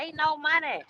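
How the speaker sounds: high, quiet mocking quote; mocking whine. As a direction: mimic a complaining driver. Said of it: "at the house talking about".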